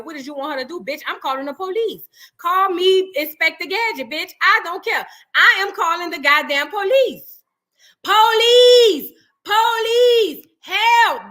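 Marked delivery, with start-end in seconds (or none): 2.4-4.3 loud, higher mock bravado; hamming up calling the cops; mock police call. Mock role-play calling police. 4.4-7.4 loud, higher insisting on the police; hammed-up defiant call; mock insistence. Mock role-play calling police. 8.0-11.3 high, loud mock cry for help; yelling for police. Pretend calling for police.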